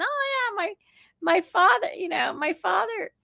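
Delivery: high-pitched child's boast, retold; imitating chatty kids. Kids telling a stranger about their father, high childlike chatter. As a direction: voice a kid proudly mentioning their father.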